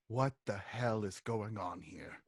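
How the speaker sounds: low, quiet in-character question; subdued, low-voiced demand; unamused questioner. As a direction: ask it softly, dropping the pitch. Character asks the party, low voice.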